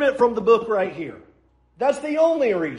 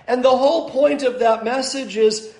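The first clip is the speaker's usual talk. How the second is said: ordinary talk, nothing marked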